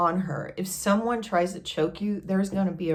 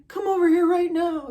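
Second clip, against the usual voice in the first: urgent call; 11 semitones higher, 6 dB louder than usual. Retold or imitated. First urgent take of the summons.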